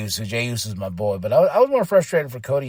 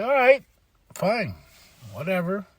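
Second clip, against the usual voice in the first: soft, dropping give-up; grudging resignation; 4 semitones higher, 3 dB quieter than usual. Retold or imitated. Fan giving up on Cody speaking, grudging resignation. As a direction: let it fall away word by word. Giving up after his outburst.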